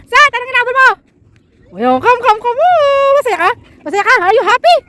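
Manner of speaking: high excited pet talk. Real, not acted. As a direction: coo at the dog happily. Baby talk to dog.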